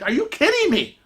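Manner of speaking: high-pitched incredulous question; retold disbelief. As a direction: replay your stunned reaction, voice shooting up. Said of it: "we just can't believe what just happened".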